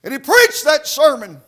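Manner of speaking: high, loud revival preaching with gasped "uh" between phrases; fiery country Baptist sermon cadence; full-force preaching. Real, not acted. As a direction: preach it hard, driving every phrase up high.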